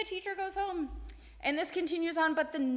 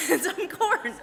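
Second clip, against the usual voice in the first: laughing; 5 semitones higher, 6 dB louder, slower than usual. Real, not acted. Laughs while telling.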